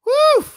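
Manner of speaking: loud, high real whoop; excited whoop. Real, not acted. Host hyping himself up, loud whoop. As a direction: let out a big fired-up cheer. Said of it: "I'm getting fired up".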